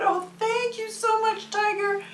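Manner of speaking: high little-frog voice; grateful little frog. Acted. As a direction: thank the hero in a tiny, high voice. Little frog thanks the tiger.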